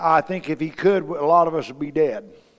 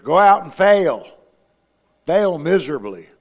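mock command; 8 dB louder than usual. Preacher's mock command, much louder.

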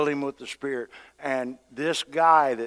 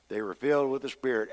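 ordinary delivery

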